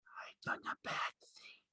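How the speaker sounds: breathless scared whisper; frightened whisper. A narrator's reading. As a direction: whisper it in horror. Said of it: "he whispered in fright".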